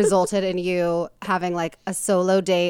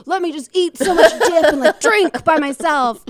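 loud, high, laughing self-mockery; mock gleeful indulgence; 8 semitones higher, 7 dB louder than usual. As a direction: belt it out high, through laughter. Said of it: "to be like".

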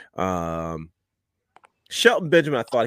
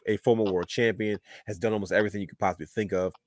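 ordinary talk, nothing marked

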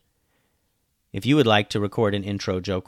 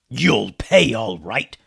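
menacing; 7 dB louder, slower than usual. Acted. Captain threatens.

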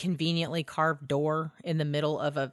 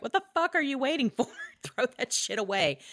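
mock-exasperated quote, pitched up and quick; Sam; 7 semitones higher than usual. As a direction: play an impatient sidekick, laughter close by. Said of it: "And Sam's like".

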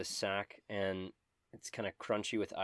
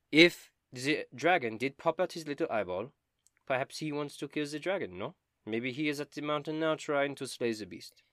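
put-on higher, lively French-style accent; strange foreign accent; 6 semitones higher, 4 dB louder than usual. Dobin reasons about the dragon, in a strange accent. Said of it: "your strange accent".

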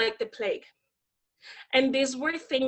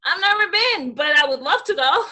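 high, loud, gleeful retort; bright retold comeback breaking into a laugh; mock-eager comeback; 5 semitones higher, 6 dB louder than usual. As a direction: answer an insult with exaggerated enthusiasm. Retells cheeky comeback to racist remark.

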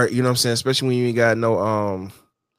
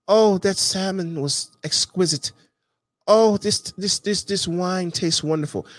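a lightly voiced snooty gourmet, slow and higher; mock fancy foodie; 5 semitones higher than usual. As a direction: savour each word like a pretentious diner. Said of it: "than sitting back".

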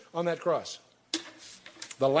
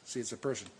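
usual speaking voice

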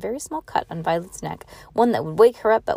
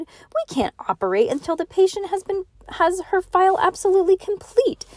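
high, insistent proclamation; pitched way up, officious insistence; excited cry; 12 semitones higher than usual. A narrator's reading. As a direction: declare it emphatically to the room. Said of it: "Klaus cried".

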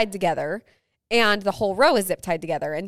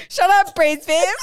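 sassy retort; 8 semitones higher, 6 dB louder than usual. Retold or imitated. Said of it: "I wanted to be like".

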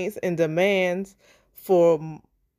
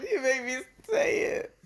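laughing accusation; 7 semitones higher, 5 dB quieter than usual. Real, not acted. Host giggling, blaming her co-host for the Barry slip. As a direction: blame them while giggling.

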